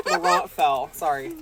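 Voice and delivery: ordinary talk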